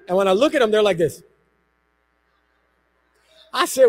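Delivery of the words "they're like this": normal talking voice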